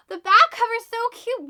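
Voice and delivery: high, loud gush of delight; delighted squeal. Real, not acted. Booktuber gasping at the cute back cover, delighted squeal.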